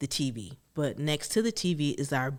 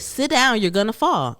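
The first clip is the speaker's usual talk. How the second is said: louder, higher sister's order; scolding warning; 5 semitones higher, 5 dB louder than usual. Retold or imitated. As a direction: snap a warning at her. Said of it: "She said".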